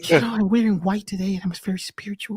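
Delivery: a put-on, airy holy-sounding tone; mock spiritual voice. Host mocks a spiritual poser with a put-on holy voice. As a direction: parody someone showing off how spiritual they are. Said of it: "This is my spiritual voice".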